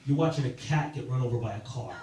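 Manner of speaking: ordinary talk, nothing marked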